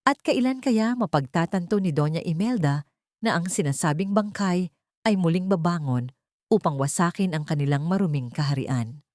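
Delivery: usual speaking voice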